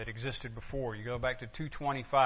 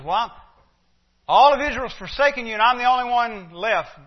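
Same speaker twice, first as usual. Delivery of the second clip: much higher, louder self-pitying protest; Elijah complaining; 9 semitones higher, 11 dB louder than usual. Acted. Elijah protesting to God, high and loud. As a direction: whine it like a worn-out prophet. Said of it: "Elijah says".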